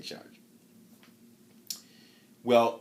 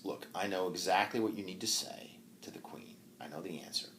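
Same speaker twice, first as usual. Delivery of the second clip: put-on old woman whispers her secret; hushed, breathy secret to the knight; whispering; 3 semitones lower, 7 dB quieter than usual. Old woman whispering the answer to the knight.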